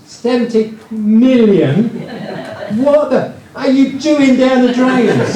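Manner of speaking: high, slow, amazed disbelief breaking into a laugh; a tenant marvelling at a millionaire in the drains, laughing; incredulous tenant. Retold or imitated. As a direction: play the gobsmacked neighbour who can't believe it. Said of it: "He goes".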